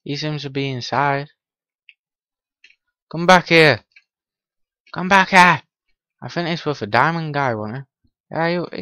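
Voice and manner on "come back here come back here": loud call, higher, after a fleeing villager. Real, not acted. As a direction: shout after him to come back. Chasing a villager in game.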